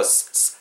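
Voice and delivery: 스 as a voiceless hiss; only the s of 스, no vowel. Shown as a demonstration. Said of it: "이 사이에 혀를 내쉬고". (스를 모음 없이 바람 소리로)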